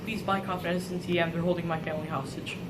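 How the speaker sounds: low, very soft begging; hushed desperate plea. Acted. Family held hostage, begging buyers, hushed and desperate. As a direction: beg under your breath, as if overheard.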